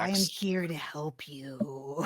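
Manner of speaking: slow whispered lizard voice, calm and soothing. Acted. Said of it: "a calm, soothing lizard voice".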